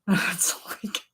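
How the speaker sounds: breathy, stifled laughing words; silent giggling. Real, not acted. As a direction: laugh quietly through the words. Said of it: "laughing silently to myself".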